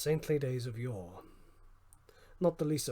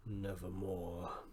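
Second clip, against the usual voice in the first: lower, softer, slow Nevermore; raven's reply; 6 semitones lower, 7 dB quieter, much slower than usual. A narrator's reading. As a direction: croak it slow and low like the raven. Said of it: "Quoth the raven".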